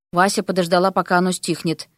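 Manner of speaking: plain reading voice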